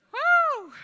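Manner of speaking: high, loud "woo"; whooping "woo". Acted. Preacher joking to congregation about the stinky room, whooping. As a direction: let out a big, long, high whoop.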